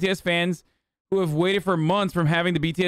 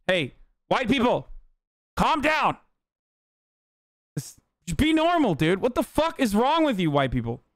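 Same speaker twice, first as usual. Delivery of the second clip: exasperated plea; 7 semitones higher than usual. Acted.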